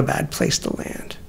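quiet whisper. Real, not acted. Closing reflection, drops to whisper.

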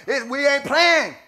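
preaching at full force. Acted. Preacher telling the church about anointing oil, loud fired-up preaching. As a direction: build it up, preacher fire.

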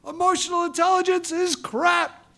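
high mock outburst of rage; blowing her stack. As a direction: let her explode, hammed up. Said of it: "blowing her stack".

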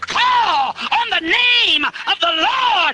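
full-force preaching. Acted. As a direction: drive it home to the congregation.

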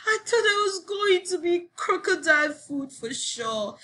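high excited storybook exclamation; high-pitched outburst; crying out. A narrator's reading. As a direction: exclaim it high, sliding gradually down. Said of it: "cried Sophie".

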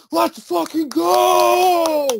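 drawn-out, high screaming cheer; mock fan yell. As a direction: imitate hyped crowd yelling over everything. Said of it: "people were too busy yelling".

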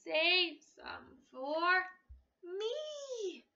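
slow, drawn-out cry; slow farmer pleading for apples; farmer's plea. Acted. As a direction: stretch it out pleading. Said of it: "cried the farmer".

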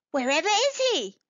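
high, startled group outcry; indignant cry. A narrator's reading. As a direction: demand it in disbelief. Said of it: "cried all the animals together".

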